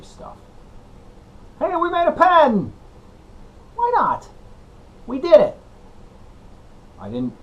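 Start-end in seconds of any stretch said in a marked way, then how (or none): 1.6-5.6 higher-pitched hammed-up cheer; mock celebration. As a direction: cheer it like a big win. Mock celebration of making pen.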